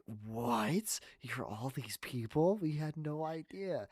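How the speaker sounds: hammed-up astonishment; mock amazement. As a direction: imitate astonished friends, drawn out. Acting out amazed friends' reaction.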